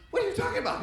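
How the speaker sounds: very high, loud incredulous cry; hammed-up high-pitched disbelief; shocked disbelief. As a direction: blurt it out in comic shock. Shocked patient answering his doctor.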